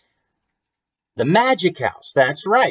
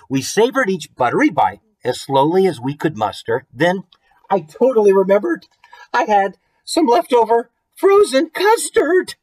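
climbs higher as she remembers the custard; excited storybook reading; 8 semitones higher, 3 dB louder than usual. A narrator's reading. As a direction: read it with growing delight.

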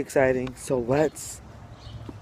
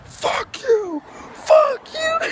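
very high squealed mock yell, building louder; imitated screaming rant; 4 dB louder, much slower than usual. Vlogger imitating the lady yelling back, squealed mock yell.

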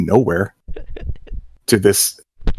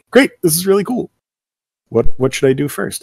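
higher, keen reply; eager new hire; 8 semitones higher than usual. Retold or imitated. New artist answers the bosses, keen and higher. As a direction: sound thrilled to start. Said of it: "And I say".

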